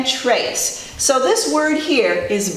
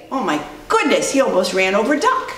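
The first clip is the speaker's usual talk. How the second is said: ordinary delivery, nothing marked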